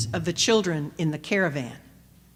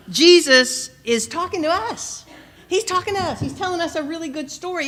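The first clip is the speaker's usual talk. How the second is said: annoyed disciple; 7 semitones higher than usual. Retold or imitated. Disciples shushing, acted as annoyed.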